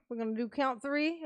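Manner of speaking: ordinary talk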